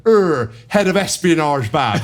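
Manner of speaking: slow, drawn-out put-on teammate voice, laughing at him; imitating Ronnie. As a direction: stretch it out like a proud dope. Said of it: "run past and goes".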